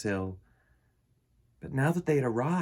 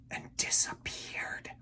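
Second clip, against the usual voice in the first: whispered, suspenseful; hushed narration; 11 dB quieter than usual. A narrator's reading. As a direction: drop to a whisper as she vanishes. Dramatic narration beat.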